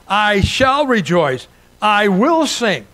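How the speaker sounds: ordinary delivery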